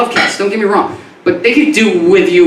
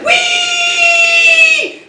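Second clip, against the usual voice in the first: squealing scream. Retold or imitated. Stepping on a rat in the street, high shriek. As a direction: shriek high at the gross surprise. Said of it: "That was me screaming".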